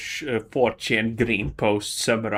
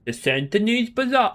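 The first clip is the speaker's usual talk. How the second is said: mock pompous name; 9 semitones higher than usual. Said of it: "someone previously called, said".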